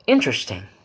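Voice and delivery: "interesting" dipping in pitch; fall-rise tone. Shown as a demonstration. Teacher demonstrates hidden meaning, fall-rise on "interesting". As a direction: say "interesting" with reservation in the tune. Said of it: "The pitch falls and rises".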